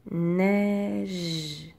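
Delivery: "Neige" very stretched, long n, vowel and final j; sound-by-sound word. Shown as a demonstration. Teacher giving pupils a word to split, elongated "Neige". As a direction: draw out every sound of "Neige". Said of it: "chaque son que tu entends".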